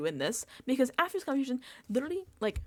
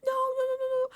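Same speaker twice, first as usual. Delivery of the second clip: much higher quick no no no, flustered; panicked backtracking; 3 dB louder than usual. Retold or imitated. Morgan backtracking to an angry Rachel, high flustered. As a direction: play the friend scrambling to take it back. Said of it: "trying to backtrack so hard".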